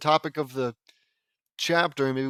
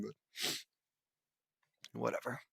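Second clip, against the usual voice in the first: whisper; 13 dB quieter, faster than usual. Real, not acted. Muttered aside to self.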